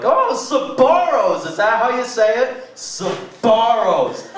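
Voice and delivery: mock a clueless shopper; dim shopper voice. Mocking dumb mall shoppers.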